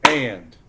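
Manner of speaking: forceful, isolated "And" for syllable counting; one-syllable beat. Shown as a demonstration. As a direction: punch out "And" alone. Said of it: "How many syllables?".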